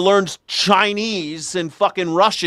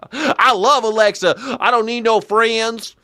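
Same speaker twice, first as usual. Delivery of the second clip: high hillbilly parody rant; mock country old-timer accent; 4 semitones higher than usual. Host as the old man loving Alexa, mock country accent. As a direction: ham up the old man's country twang. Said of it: "speak in that type of accent".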